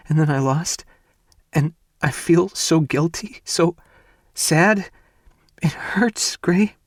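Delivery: desperate tearful rising plea; sobbing. Acted. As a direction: let the grief spill, pitch rising toward a wail. Ivy sobbing over her loss.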